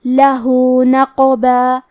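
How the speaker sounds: "لہو نقبا" chanted very slowly, the "ہو" held long; lengthened madd. Shown as a demonstration. Teacher shows two-count lengthening, slow chanted "لہو نقبا". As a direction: draw out the vowel ending "لہو", then "نقبا". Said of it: "دو مد کے برابر لمبی کریں گے".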